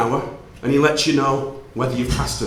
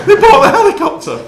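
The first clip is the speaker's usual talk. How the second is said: louder, much higher laughing disbelief; incredulous laughing exclaim; 12 semitones higher, 9 dB louder than usual. Real, not acted. Speaker tells the audience his mother bought a helicopter, laughing disbelief. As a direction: blurt it out, half laughing.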